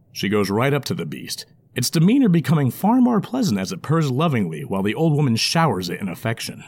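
plain reading voice

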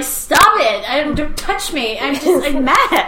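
high, loud imitation of someone objecting; mock protest. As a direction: yelp it in mock outrage. Acting out a scared, annoyed viewer's protest.

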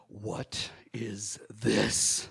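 slow, breathy whispered question; mother whispers it slowly, deadly calm; low whisper. Retold or imitated. Mother confronts her child in the store, whispering slowly. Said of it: "in a low, pronounced tone".

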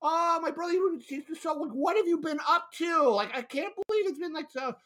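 high, bubbly nonstop chatter; chatty sister. Retold or imitated. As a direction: imitate her gushing catch-up. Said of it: "she just keeps talking like".

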